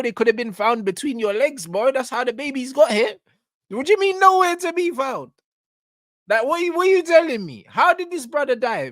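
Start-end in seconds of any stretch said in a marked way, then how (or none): 3.7-8.9 incredulous. Real, not acted. Incredulous questioning of guest.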